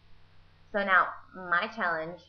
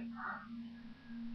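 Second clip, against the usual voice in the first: sharp gasp; 14 dB quieter than usual. Retold or imitated. Nessie recognizes the moon.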